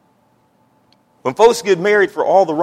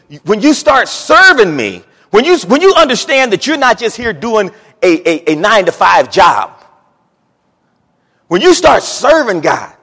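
higher, louder, rising preacher fervor; preaching at full force; 5 semitones higher, 7 dB louder than usual. Acted. Pastor urging the congregation, loud and high.

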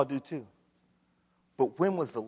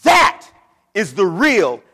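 full-force preaching; 5 semitones higher, 11 dB louder than usual. Acted. Pastor to congregation at the climax, full-force preaching. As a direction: drive it home to the pews.

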